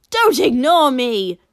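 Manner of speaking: loud furious shout; angry yell. Acted. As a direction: bellow at him in rage. Angry fighter yells at Shoto.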